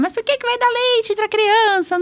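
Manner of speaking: high, incredulous mock critic voice; mocking vegan critic. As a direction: squeak out the outraged objection like a critic online.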